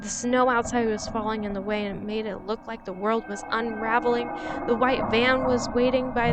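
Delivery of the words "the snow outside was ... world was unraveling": ordinary delivery, nothing marked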